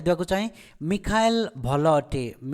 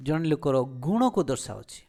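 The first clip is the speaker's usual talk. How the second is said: ordinary talk, nothing marked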